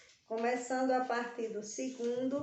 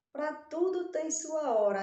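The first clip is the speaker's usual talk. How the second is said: higher, louder, like a mother's saying; a hint of a grandmother's old saying; saying of moms and grannies; 6 semitones higher, 5 dB louder than usual. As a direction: quote it like a wise old mom. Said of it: "a gente ouve muito as mamães e as vovós".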